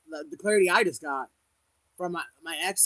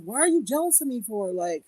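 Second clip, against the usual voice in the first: high, loud exasperated plea; incredulous, pleading high voice; pleading to sister; 8 semitones higher, 4 dB louder than usual. Retold or imitated. To her jealous sister, exasperated high plea. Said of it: "it's like".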